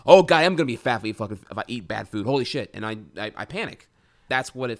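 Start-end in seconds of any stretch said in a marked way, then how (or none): 0.1-2.7 much louder and fast, panicking; frantic fear-driven outburst; panicked rush. Retold or imitated. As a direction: blurt it out in a spiralling panic. Replaying the panic thought.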